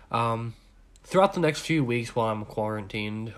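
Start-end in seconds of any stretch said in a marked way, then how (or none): none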